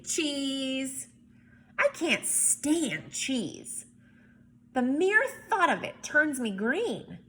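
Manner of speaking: lively picture-book read-aloud; story narration. A narrator's reading. Teacher reading a picture book to kids, lively narration.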